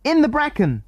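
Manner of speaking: high shout across the dark. A narrator's reading. Jill calls to the men from the bracken with a high shout. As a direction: call it out high to be heard far off. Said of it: "shouted Jill".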